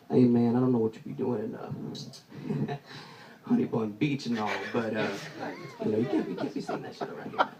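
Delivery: low, hushed friendly warning; lightly voiced buddy warning. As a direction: pull him aside and warn softly. Buddy gently warning him.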